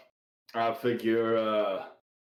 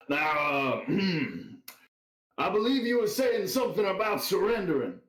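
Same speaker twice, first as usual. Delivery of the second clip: taunting; 7 semitones higher than usual. Acted. Player taunts dying villain.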